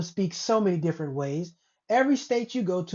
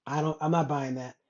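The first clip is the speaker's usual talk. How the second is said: ordinary delivery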